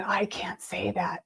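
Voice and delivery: unvoiced whisper, slow and hushed; whispered thought. To herself, fearing to pass on the message: slow whisper. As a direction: breathe the words as a secret thought. Inner thought, afraid to speak.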